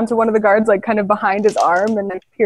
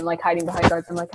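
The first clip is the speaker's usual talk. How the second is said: ordinary talk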